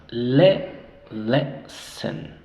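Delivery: "lesson" as strong LE, weak "sn"; schwa in second syllable. Shown as a demonstration. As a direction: stress "Le" loudly, reduce the end of "lesson". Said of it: "suena reducido a un schwa".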